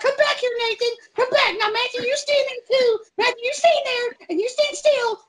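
very high, shrieky mom impression; mock commanding mom. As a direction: imitate a mother ordering kids around. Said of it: "then his mom comes out".

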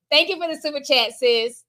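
ordinary talk

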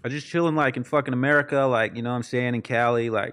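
laid-back Californian American accent. Shown as a demonstration. British guest showing hosts his best American accent. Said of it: "I wanna hear your best American accent".

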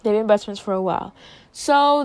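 ordinary talk

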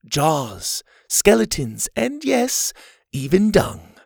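lightly voiced genteel leader; camp leader Ma. A narrator's reading. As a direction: address the campers like a kindly matron. Ma's speech.

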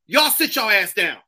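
loud, sharp order; commanding. Acted. Comic acting out an order to sit, loud command. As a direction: order them to sit, loudly. Acted order in comedy bit.

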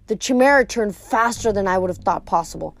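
ordinary delivery

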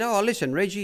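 a high, chirpy put-on trainer's voice; mock-cheerful boss dropping by; imitating Bart, breezy. Bart asks a favour.

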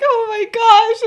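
high, loud, drawn-out gush; overjoyed squeal. Retold or imitated. Woman delighted by the boy's news, imitated overjoyed squeal. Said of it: "but she's so happy".

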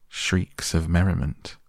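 ordinary delivery

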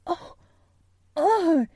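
hushed oh, then a high loud one; delighted cry. A narrator's reading. Colin hearing Dickon will visit, crying out in delight. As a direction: let delight burst out on the second oh. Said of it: "Colin cried out in delight".

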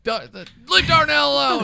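high, loud acted protest; mock plea. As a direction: call out to break up a fight. Acts out pleading during retold attack.

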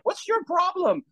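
high, cranky outburst; mock grumpy self; irritated snap. Speaker without morning Bible time, high irritated snap. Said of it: "I'm like".

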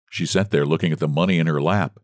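ordinary delivery

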